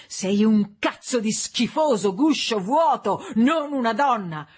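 furious insult. A narrator's reading. Husband hurling abuse at his wife, furious. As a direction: hammer out the insult at full voice. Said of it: "mentre la voce gli saliva di tono".

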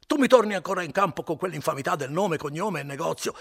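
put-on drunken indignant cry; furious exclamation. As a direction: bellow the insult. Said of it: "Renzo continuava più forte".

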